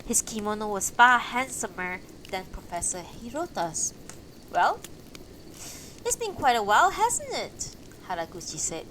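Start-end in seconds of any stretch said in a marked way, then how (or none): 4.5-7.8 cheerful greeting. Acted. Haraguchi greets, affirmative voice.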